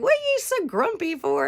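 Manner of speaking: high-pitched imitation of a complaining husband; mock bickering. Husband asking wife about her mood, mock-testy. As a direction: throw it out as a high mock gripe.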